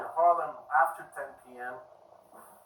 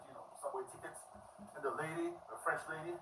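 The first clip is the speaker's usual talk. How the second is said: usual speaking voice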